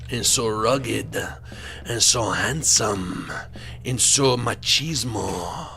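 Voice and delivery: each phrase drawn out slowly, savoring; slow swooning. Acted. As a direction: linger on every word like a daydream. Swooning list of traits.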